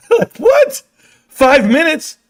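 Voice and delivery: very high, loud what; incredulous. Real, not acted. Host scoffing at critics, high incredulous outburst. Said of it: "incredulous reply".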